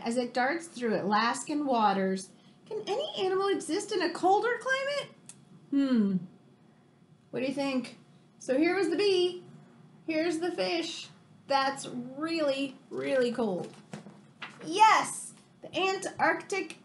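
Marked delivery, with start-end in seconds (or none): 2.7-5.1 high, fast, teasing question; quick and higher, building suspense; eager question. Acted. Rereads the book's refrain. 14.6-15.3 high bright exclaimed yes; thrilled little cheer of yes; excited yes. Acted. Answers refrain excitedly.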